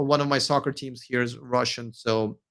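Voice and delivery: normal talking voice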